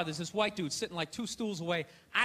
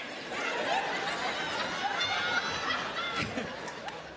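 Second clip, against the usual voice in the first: very high, airless shriek of rage; strangled high howl. Retold or imitated. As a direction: let out a choked, furious squeal. Imitated angry fan noise.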